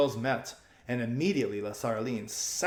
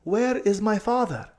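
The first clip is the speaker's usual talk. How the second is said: higher, pressing and hurried; urgent girl; 10 semitones higher, 6 dB louder, slower than usual. A narrator's reading. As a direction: beg her to act fast. Aravis voiced.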